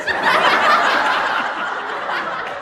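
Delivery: loud, high burst of laughter; high squealing laugh. Real, not acted. Laughing at her own story.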